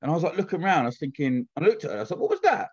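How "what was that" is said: high startled question; mock surprise; puzzled. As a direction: sound baffled by the noise. Puzzled at strange sound.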